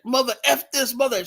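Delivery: high-pitched imitation of a woman yelling in the stands; mock shouting. Imitating a woman shouting at a graduation, high mock yell. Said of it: "sh-, shouting one night".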